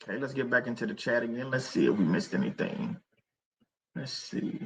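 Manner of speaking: very soft, low mumble while checking; hushed, trailing almost to a whisper; talking to oneself. Real, not acted. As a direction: murmur absently as you scan messages. Checking the chat to himself.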